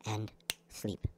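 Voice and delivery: soft whispered command to sleep. Acted. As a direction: breathe the word out as a whisper.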